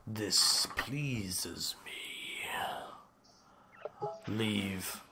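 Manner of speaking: low, slow whispered dragon; an ancient dragon whispering orders. Acted. Dragon dismisses the party.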